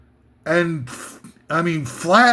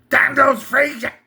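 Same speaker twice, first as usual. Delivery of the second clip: Cosell impression; 6 semitones higher than usual. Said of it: "Howard Cosell's famous ringside announcing".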